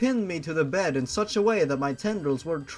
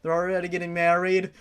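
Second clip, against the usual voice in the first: ordinary delivery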